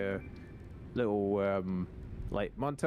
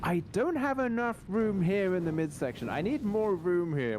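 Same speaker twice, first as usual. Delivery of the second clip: higher-pitched, fussy complaint; mock exasperation; 6 semitones higher than usual. Shopper trying on tight jeans, mock-exasperated. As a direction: complain in a raised, put-upon voice. Acting out frustrated jeans fitting.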